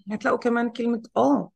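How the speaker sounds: ordinary talk, nothing marked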